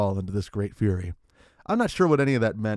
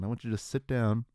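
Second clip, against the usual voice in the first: ordinary delivery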